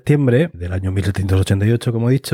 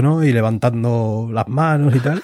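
ordinary delivery

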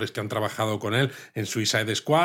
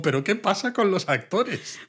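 much higher, bewildered question; mock bafflement; 9 semitones higher than usual. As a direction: ask it in exaggerated disbelief. Mock puzzled question about actors. (desconcierto exagerado, mucho más agudo)

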